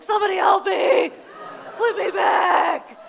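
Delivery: hammed-up distress shout; mock cry for help. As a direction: call out like a flipped turtle. Stuck on back, calling.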